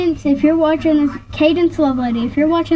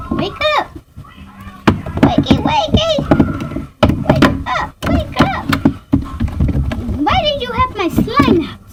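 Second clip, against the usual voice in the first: put-on high kid voice, pleading him to wake up; child waking daddy; 11 semitones higher than usual. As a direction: play the little kid tugging at daddy. Doll plays child waking daddy.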